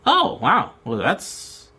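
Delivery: ordinary delivery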